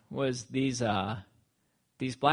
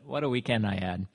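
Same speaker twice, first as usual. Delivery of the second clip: normal talking voice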